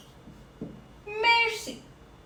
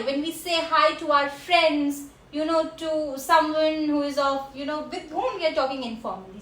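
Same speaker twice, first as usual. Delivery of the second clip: usual speaking voice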